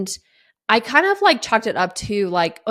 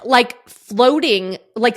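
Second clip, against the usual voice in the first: normal talking voice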